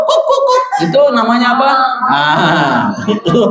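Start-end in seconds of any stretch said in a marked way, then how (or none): 0.1-0.6 high yapping kuk kuk kuk; dog bark imitation. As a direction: yap like a little dog. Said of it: "yang bunyinya kuk".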